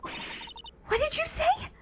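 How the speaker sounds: very high and slow, stunned; high stunned girl, hearing the bridge burned; shocked girl voice. Acted. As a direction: drag it out high in disbelief. Voicing a shocked character.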